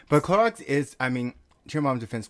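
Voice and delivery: usual speaking voice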